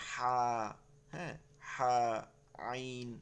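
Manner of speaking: হা and আইন said lightly; light articulation. Shown as a demonstration. Teacher showing students the light letters, হা and আইন lightly articulated. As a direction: draw out হা and আইন with a light touch. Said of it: "হালকা করে".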